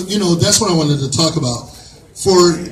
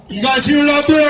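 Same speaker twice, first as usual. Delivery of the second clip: louder, higher call out to the room; rally the audience to cheer; calling to the crowd; 6 semitones higher, 4 dB louder than usual. Real, not acted.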